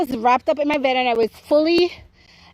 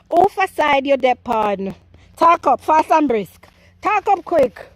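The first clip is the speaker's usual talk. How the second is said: Jamaican patois; 3 semitones higher than usual. Pretend. Gardener pressing Marvel to speak up, animated Jamaican accent. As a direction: challenge them playfully in animated Jamaican patois. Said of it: "my patois turn up".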